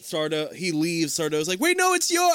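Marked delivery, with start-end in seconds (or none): 1.6-2.3 high-pitched mock protest; flustered objection, voice jumping way up. Acting [name]'s protest in retelling.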